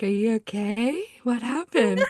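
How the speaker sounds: lightly voiced worried teacher, lifting at the end; concerned professor. Professor finding crying student in the dark, mildly imitated concern. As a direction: gingerly check on someone upset, imitated.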